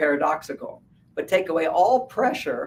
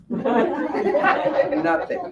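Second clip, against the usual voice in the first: nothing laughed out up high; laughing; 12 semitones higher than usual. Real, not acted. Mocking husband's empty answer, high laughing. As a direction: repeat it through a laugh.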